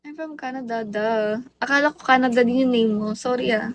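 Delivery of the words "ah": ordinary talk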